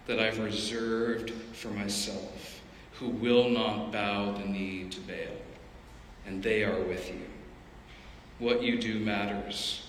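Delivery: God's hushed, reassuring answer to Elijah; gentle God voice. Acted. Voicing God's gentle reply.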